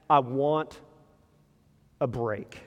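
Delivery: slow, drawn-out plea for a break; the author pleading with God how long, slow and weary. Retold or imitated. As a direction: beg God wearily, stretching each word.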